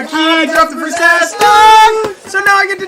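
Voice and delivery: high, loud, slow sing-song tease; gleeful taunt. Acted. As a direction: crow it like a victory call. Teasing announcement of slip.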